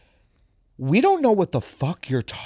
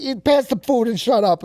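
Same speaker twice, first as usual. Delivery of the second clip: higher, mock-irritated dismissal; impatient dad; 7 semitones higher than usual. Father shutting down pronoun talk at dinner, mock impatient. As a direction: imitate a dad cutting the chat short. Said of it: "He's like".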